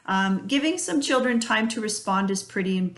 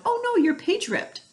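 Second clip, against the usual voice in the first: put-on high, sympathetic oh no for the child; mock surprise; 13 semitones higher than usual. Shown as a demonstration. Parent shows the child concern over a ripped page, mock surprise. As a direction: sound surprised to draw the child in. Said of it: "And you respond".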